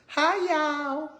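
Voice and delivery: high, bright-eyed "Hi, y'all" in a put-on voice; imitating that girl. Mimicking the mukbang girl greeting her viewers. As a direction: greet like the eager food video girl. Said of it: "that girl that goes".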